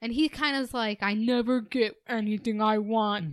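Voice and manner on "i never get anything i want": lightly imitating a self-pitying complainer; mock woe-is-me whine of a rejected guy; mock sulking. Podcaster voicing a rejected friend's complaint, mock sulking.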